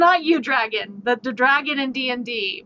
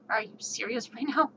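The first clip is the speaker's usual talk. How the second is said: muttered disbelief; 10 dB quieter than usual. Retold or imitated. Disbelief at second check.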